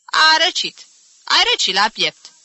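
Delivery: loud, high, emphatic verdict; insistent interjection. Acted. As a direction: break in with total certainty. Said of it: "interveni Agepsina".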